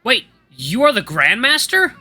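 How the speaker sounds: loud, slow, high astonished shout; surprised shout. Acted. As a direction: blurt it out too loud. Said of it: "I shout louder than I intended".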